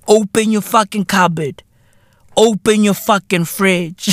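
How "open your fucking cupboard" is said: ordinary delivery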